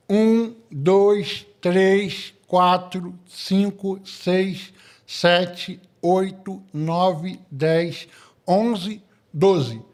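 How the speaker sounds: counting with a pen in the mouth; pen in teeth. Shown as a demonstration. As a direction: talk around a pen held in the teeth. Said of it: "Botar a caneta na boca".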